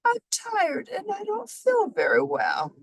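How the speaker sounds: high, weak and tired; a sick moose calling out faintly. A narrator's reading. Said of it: "A weak voice called out".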